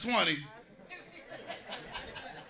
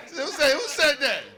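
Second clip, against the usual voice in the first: mock outrage; 8 semitones higher, 3 dB louder than usual. Mock outrage at crowd heckle.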